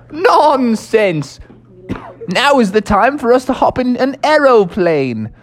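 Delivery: put-on high, hearty old English toad; jolly Mister Toad. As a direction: boast like a merry old gentleman toad. Mister Toad voiced in story.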